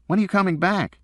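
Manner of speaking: put-on a kid wanting his dad home; young son. A narrator's reading. Son on the phone asking when his father returns, pleading.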